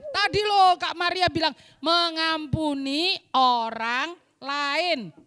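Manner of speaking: usual speaking voice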